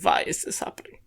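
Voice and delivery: mock-baffled German accent; helplessly German-accented; stuck in German accent. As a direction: lament it with German v sounds. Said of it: "It's still going very German".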